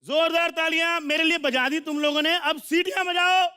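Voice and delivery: high, stretched-out guest introduction; stage announcer. Real, not acted. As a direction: build the crowd up, slowing to the name. Host announces next guest to crowd.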